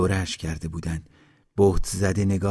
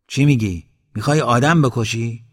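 louder, higher-pitched challenge; indignant scolding questions; shocked reproach; 3 semitones higher, 6 dB louder than usual. A narrator's reading. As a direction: protest in a raised voice, appalled. Headman protests at a planned killing.

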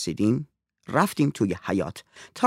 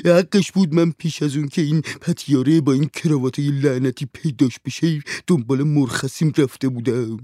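whining servant; 5 semitones higher, 5 dB louder than usual. A narrator's reading. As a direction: moan about your bad luck, full of complaint. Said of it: "هنسام ناله کنان گفت".

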